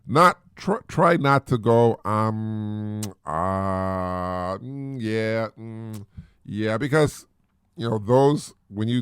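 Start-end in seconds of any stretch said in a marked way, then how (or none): 2.0-6.8 demo filler sounds. Shown as a demonstration. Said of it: "the ohs and the ahs".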